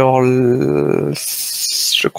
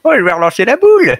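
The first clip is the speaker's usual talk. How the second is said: put-on old voice redone; 6 semitones higher, 4 dB louder, faster than usual. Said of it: "il nous l'a refait en live".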